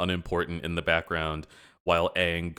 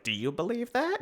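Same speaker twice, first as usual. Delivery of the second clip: high mock Aang. Aang asking Iroh through the bars, high and hopeful. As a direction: ask it high and wide-eyed. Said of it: "And Aang's like".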